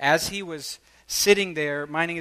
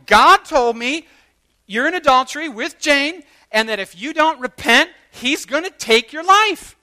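urgent warning; 9 semitones higher, 9 dB louder than usual. Retold or imitated. Delivering the prophecy to the man.

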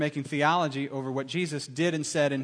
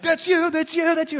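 much higher, louder urgent insistence; excited Brad; 13 semitones higher, 8 dB louder than usual. Retold or imitated. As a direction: nudge your brother eagerly. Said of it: "Brad's like".

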